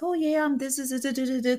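mock polished interviewee; mock on-camera voice. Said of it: "'cause I'm on camera".

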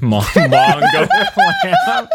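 drawn out through laughter; high laughing disbelief. Real, not acted. Cracking up at name.

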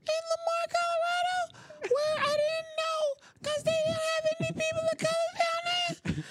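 tiny falsetto for the whole story; highest voice. Pretend. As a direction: tell it all at the top of your range. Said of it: "in your highest voice".

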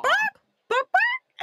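high yappy dog barks; barking. Retold or imitated. Gibby barking at Carly to mock her, high yaps. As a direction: bark like a little dog, high. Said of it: "he starts to bark".

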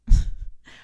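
a quick amused laugh before the point; knowing laugh. Real, not acted. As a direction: laugh as if the answer is obvious. Laughs mid-retort.

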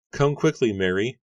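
high, loud, pleading urgency; urgent insisting. A narrator's reading. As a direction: rush her along in fear. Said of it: "Rebecca insisted".

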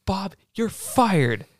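high, slow mock proclamation; angry firing. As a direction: act out firing someone, drawing it out. Retold fight, Willie fires Bob.

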